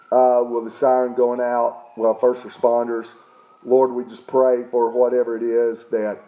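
low, level praying voice; spoken prayer. Real, not acted. Pastor praying for first responders, low and even prayer.

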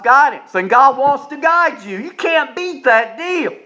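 rising revival preaching; full-force preaching. Acted. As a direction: preach it hard to the congregation. Preaching climax.

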